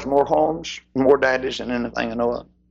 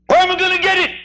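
sinner's question pitched high and loud; anxious sinner; 4 dB louder, faster than usual. Acted. Sinner asking Doctor Law, high anxious plea. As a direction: cry it out like a frightened patient.

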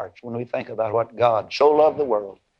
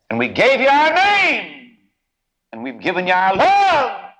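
tender father; 9 semitones higher, 5 dB louder, slower than usual. Acted. Father reassures adopted son.

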